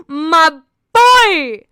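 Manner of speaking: loud, swooping fangirl cry; excited fan cheer. Retold or imitated. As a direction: burst out with proud, fannish glee. Acts out her fan reaction.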